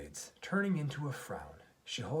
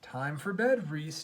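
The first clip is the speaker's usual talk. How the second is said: louder, higher call on BED; daddy calling bedtime; 4 semitones higher, 7 dB louder, slower than usual. A narrator's reading. Daddy telling Reese it's bedtime, firm announcement. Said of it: "Daddy utters".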